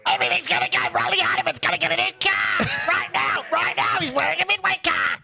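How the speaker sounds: very high-pitched, shouted comic voice; Mister Bankrupt. Acted. Co-host performing on request, high shouted character voice. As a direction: shriek it at the top of the range. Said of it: "can you do your Mister Bankrupt".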